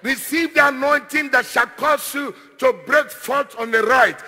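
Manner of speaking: loud, commanding declaration; emphatic, drawn-out preacher's proclamation; forceful preaching. Real, not acted. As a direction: pronounce the blessing with mounting force. Commands blessings on congregation.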